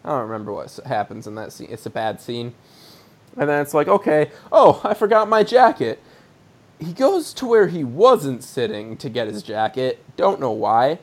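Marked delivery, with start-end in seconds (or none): 3.9-6.0 higher, louder mock "oh!"; hammed-up sudden remembering; mock sudden realisation. Host acts the kid remembering his jacket, mock sudden realisation. Acting out episode character's line.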